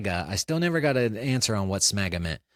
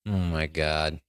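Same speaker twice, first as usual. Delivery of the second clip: normal talking voice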